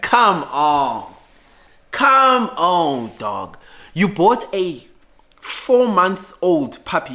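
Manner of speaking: slow, disbelieving sarcasm at the buyer; incredulous mockery. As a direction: spell out how absurd the bargain is. Mocking the man's cheap purchase.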